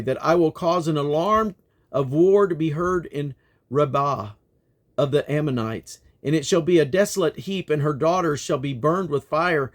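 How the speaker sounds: steady, declaiming Bible reading; King James reading voice, lifted and measured; scripture reading. Acted. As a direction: proclaim the prophet's words to the congregation. Reading Jeremiah aloud to listeners.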